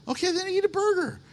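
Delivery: high, quick comeback to a moaner; brisk retort. Acted. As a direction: toss back the obvious fix, fast and high. Acts out reply to complainer.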